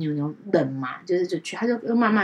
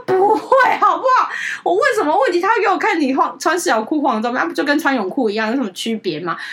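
much higher, louder, laughing retort; laughing dismissal; 8 semitones higher, 6 dB louder than usual. Retold or imitated. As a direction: laugh off his worry as absurd. Laughing dismissal of boyfriend's fear.

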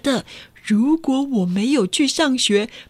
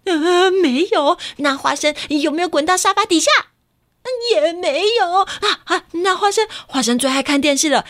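frantic search; 8 semitones higher than usual. Acted. Mother searching, child answering.

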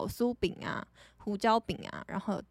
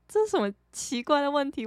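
raised, louder baffled inner question; dumbfounded disbelief; 6 semitones higher, 3 dB louder, faster than usual. Retold or imitated. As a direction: replay your stunned reaction to a strange question. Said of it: "我就傻眼". (提高声调、带点不可置信的惊讶)

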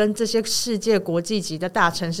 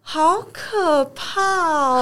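drawn-out, very slow, higher shudder; scared; 7 semitones higher, much slower than usual. Real, not acted. Recalling his attempted kiss to friends, slow horrified drawl. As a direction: stretch it out, still spooked. Reliving the scary near-kiss.

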